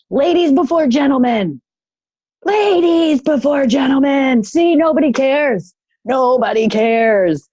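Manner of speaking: high, stretched-out mock holler; imitated yelling. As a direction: imitate an old man hollering down a queue. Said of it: "he starts yelling".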